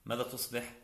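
ordinary talk

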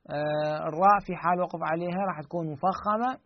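ordinary delivery

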